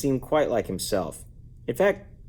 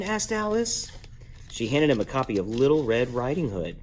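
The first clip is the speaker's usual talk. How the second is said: ordinary delivery, nothing marked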